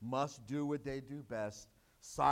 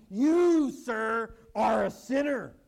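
loud pointed rebuke, higher pitch; stern accusation; 4 semitones higher, 11 dB louder, slower than usual. Acted. God confronting a man in the congregation, loud stern rebuke. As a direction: call him out hard and loud.

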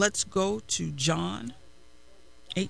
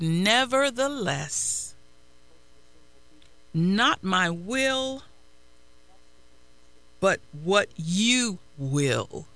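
slow surrender; slower than usual. Acted. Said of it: "But Jesus said".

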